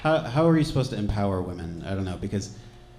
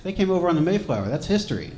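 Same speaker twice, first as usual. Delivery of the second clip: higher, mildly insistent retort; imitating insistent mom; 7 semitones higher, faster than usual. Mom defends her pilgrim ancestry, mock-insistent. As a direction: lightly mimic someone sure of it. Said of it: "she was sure of it".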